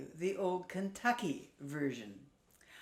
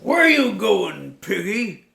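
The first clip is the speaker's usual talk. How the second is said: slow, loud, sly wolf voice; a wolf sizing up his dinner; loud drawn-out wolf; 4 semitones higher, 16 dB louder than usual. Acted. Wolf questions the pig.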